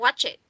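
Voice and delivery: "watch it" linked as "wa-chit"; linked words. Shown as a demonstration. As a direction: join "watch it" into one smooth word. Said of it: "chúng ta có thể nối từ được nhá". (nối âm "watch it" thành "wa-chit")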